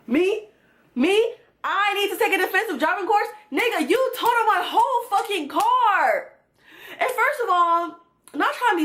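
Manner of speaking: high, incredulous outburst; indignant disbelief. Real, not acted. Reacting to the ex telling her to take a driving course, high incredulous disbelief. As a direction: throw the question back at him, offended.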